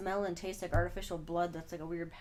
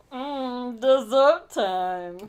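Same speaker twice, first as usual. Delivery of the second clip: kaiju voice; 4 semitones higher, 10 dB louder, much slower than usual. Pretend. Voicing the kaiju eating.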